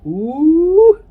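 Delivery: high, loud delighted ooh; excited ooh. Real, not acted. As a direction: squeal with delight at the taste. First taste with sauce.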